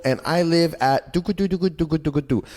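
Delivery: a hint of a child reciting. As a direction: recite like a kid on tape. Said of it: "film yourself as a child being like".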